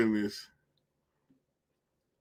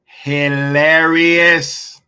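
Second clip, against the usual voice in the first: very slow, louder, laughing; laughing drawl; 4 semitones higher, 7 dB louder, much slower than usual. Real, not acted. Streamer to chat, slow loud laughing verdict. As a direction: stretch it out, cracking up.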